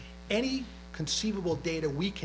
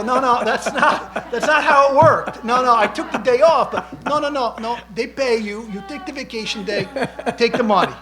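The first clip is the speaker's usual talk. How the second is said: laughing protest; 9 semitones higher, 14 dB louder than usual. Retold or imitated. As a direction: explain it through laughter, loud and high. Laughing retelling his own protest.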